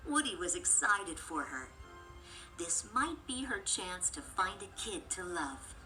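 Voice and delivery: plain reading voice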